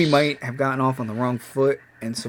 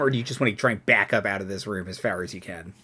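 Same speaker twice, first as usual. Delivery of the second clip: ordinary delivery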